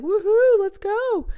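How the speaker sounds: loud, high-pitched whoop; party cheer. Acted. Younger crowd ready to party in Vegas, excited cheer. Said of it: "everyone else is gonna be like".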